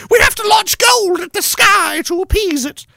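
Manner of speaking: very high, drawn-out hysterical cry; mock zealot shriek. Host parodying climate believers, hammed-up shrieking. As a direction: wail it like a crazed doomsayer.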